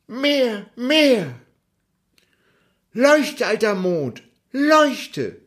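hohe, fordernde Kinderstimme; gieriges Kind. Acted. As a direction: ruf es hoch und fordernd wie ein Junge. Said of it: "rief er". (Häwelmann ruft dem Mond hoch und gierig zu)

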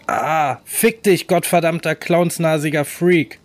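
high, loud pained scream. Acted. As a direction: scream it through the pain. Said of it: "seinen Fänger anschrie".

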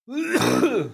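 high hacking cough; coughing after choking; coughing fit. Real, not acted. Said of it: "ich hab mich verschluckt".

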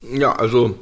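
talking while chewing; mouth full. Real, not acted. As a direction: speak around a mouthful of food. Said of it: "Hast du jetzt wirklich gegessen?".

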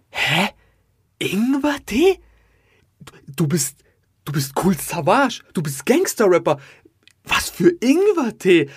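stammer, then pitch up in bewildered protest; shocked disbelief. Retold or imitated. Fan hears his gangster-rap idol order ginger tea, shocked and incredulous. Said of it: "ich war so geschockt".

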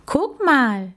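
sudden call. A narrator's reading. Max spots a signpost and calls to Mucks. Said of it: "rief Max plötzlich".

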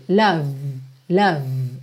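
"Love" ending crisply on the V. Shown as a demonstration. A teacher stressing the final V in "Love". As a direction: finish "Love" on the V with no vowel after. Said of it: "terminar no V".

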